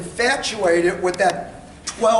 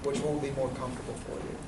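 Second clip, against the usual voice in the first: quiet trial close; 5 semitones lower, 8 dB quieter than usual. Shown as a demonstration. Salesman demo to couple.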